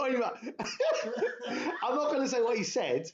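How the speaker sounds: high-pitched laughter breaking through the words; cracking up. Real, not acted. Recalling ribbing [name] about [name], laughing hard. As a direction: laugh through it, barely able to speak. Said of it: "I was cracking up".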